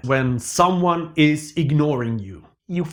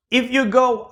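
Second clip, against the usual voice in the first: normal talking voice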